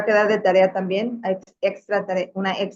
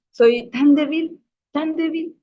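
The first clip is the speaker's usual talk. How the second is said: slow, higher, self-pitying with a laugh; self-mocking weakness; 7 semitones higher, 3 dB louder, slower than usual. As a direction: play up your own frailty. Se presenta débil con ironía. (tono burlón de debilidad)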